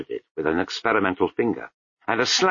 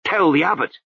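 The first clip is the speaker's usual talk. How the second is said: snapped; 9 semitones higher, 4 dB louder than usual. A narrator's reading. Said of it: "Lutzer snapped".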